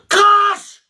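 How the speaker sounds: loud, high teacher's shout; barked order. Acted. Teacher demanding the gum, loud high order. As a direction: demand it at full voice.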